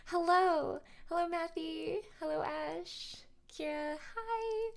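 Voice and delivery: high, sweet greetings to chat; cute anime streamer. Real, not acted. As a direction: sing each viewer's name cutely. Greeting chatters by name, high.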